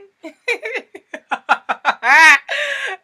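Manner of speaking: high, loud cackling laugh; long high laugh, falling away; real cackle. Real, not acted. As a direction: burst out laughing at your own joke. Bursts out laughing at own joke.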